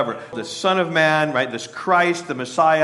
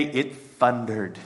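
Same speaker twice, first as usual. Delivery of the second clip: dismissive; 4 semitones lower, slower than usual. Retold or imitated. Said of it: "and they say".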